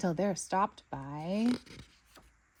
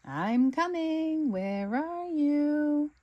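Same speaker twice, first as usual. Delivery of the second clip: slow, higher, sing-song calling; searching terrier; 8 semitones higher, much slower than usual. Acted. Terrier hunting for hidden Snickers, slow higher sing-song call. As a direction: stretch each word while peering around.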